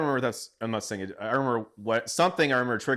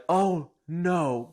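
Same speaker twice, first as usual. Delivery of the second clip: retold alarm; 8 semitones higher, 5 dB louder than usual. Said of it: "and I'm like".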